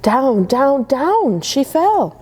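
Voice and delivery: usual speaking voice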